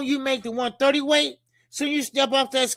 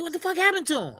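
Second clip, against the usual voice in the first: higher, disbelieving quote; stunned; 8 semitones higher, much faster than usual. Retold or imitated. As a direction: replay the shock of seeing him. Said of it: "I said".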